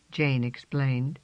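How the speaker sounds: usual speaking voice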